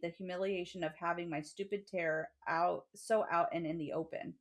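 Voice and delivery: steady, even reading of a book passage; reading aloud. Acted.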